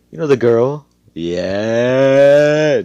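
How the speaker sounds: long, loud, high drawn-out yeah; mock hype. Storyteller teasing viewers about the girl, mock hype. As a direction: stretch it out as a teasing cheer.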